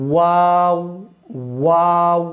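ওয়াও with lips pushed out like a bird's beak; lips rounded forward. Shown as a demonstration. Teacher showing students the mouth shape for ওয়াও. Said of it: "ঠোঁটটা পাখির ঠোঁটের মতো লম্বা হবে".

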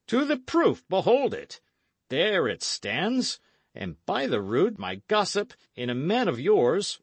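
high, loud, pointed gloating; triumphant accusation. Acted. Sir Daniel triumphantly accusing Lord Shoreby, gloating. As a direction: prove your point with relish.

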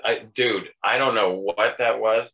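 usual speaking voice

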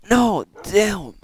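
loud, high frustrated outburst; frustrated shout. Real, not acted. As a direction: yell it out in frustration. Gets killed, shouts.

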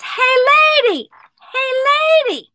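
high-pitched bird call; talking parakeet. Acted. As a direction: squawk for her attention like a parrot. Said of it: "the bird says".

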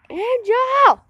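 high-pitched call for a lost friend. Real, not acted. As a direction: call into the dark for someone.

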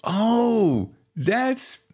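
high, drawn-out "oh"; high dawning realisation; retold recognition. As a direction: act out spotting your friend in a book. Acts out recognising friend in book.

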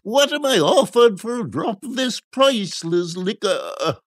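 high auctioneer-like call; high, theatrical sales shriek; shrieking quack. A narrator's reading. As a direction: cry your wares at high pitch. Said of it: "shrieked the quack".

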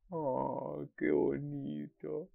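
soft, slow, touched murmur; tender aside. Real, not acted. Fan moved by the couple, soft and slow. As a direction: sigh over something sweet.

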